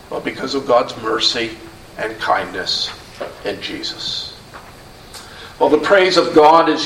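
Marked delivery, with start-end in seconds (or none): 0.1-4.3 fading hush. Real, not acted. Preacher closing a point on Jesus, fading to a hush. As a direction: voice sinks away, near whispered at the end. Sermon point trailing to a close.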